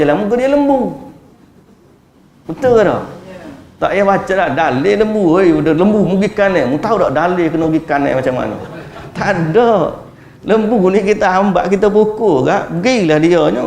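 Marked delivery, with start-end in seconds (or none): none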